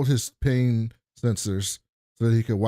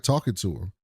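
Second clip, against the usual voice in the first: ordinary talk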